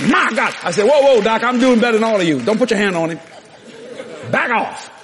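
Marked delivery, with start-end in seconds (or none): 0.0-0.5 high, loud shocked outburst; astonished exclamation. Retold or imitated. Doctor sees the boy wake up, loud high astonishment. 0.8-4.9 loud, high fending-off; urgent warning. Retold or imitated. Preacher stops doctor from touching the boy, loud urgent warning.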